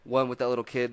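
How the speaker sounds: normal talking voice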